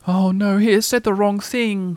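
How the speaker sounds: high, drawn-out worried Piglet; mildly anxious little-creature voice. A narrator's reading. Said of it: "thought Piglet anxiously".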